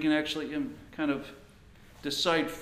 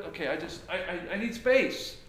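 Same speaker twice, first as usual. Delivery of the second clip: a touch higher and louder, flustered pleading; flustered husband; 6 semitones higher, 3 dB louder than usual. Retold or imitated. Frustrated husband asks his wife for space, mildly flustered. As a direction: act out a frazzled husband begging for space.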